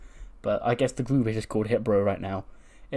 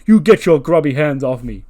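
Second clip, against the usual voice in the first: loud mock [name]; 4 semitones higher, 11 dB louder than usual. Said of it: "He'd go".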